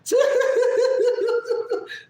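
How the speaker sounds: high, stuttering put-on laugh; long pulsing mock cackle; imitated friend's laugh. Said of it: "That's how he laughs".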